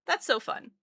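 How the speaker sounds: ordinary delivery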